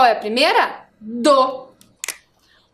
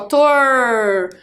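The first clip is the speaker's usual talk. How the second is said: "Tor" stretched long so the r is heard; lengthened syllable. Shown as a demonstration. Teacher showing the syllable is longer, "Tor" drawn out. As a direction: hold "Tor" out to its final r. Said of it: "ela é um pouquinho mais longa".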